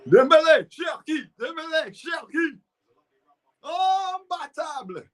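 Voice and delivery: very high-pitched football commentator cry; high held notes, last word stretched out slow; excited commentator. Acted. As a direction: call out the players' names like a thrilled commentator. Mimics an excited match commentator's calls.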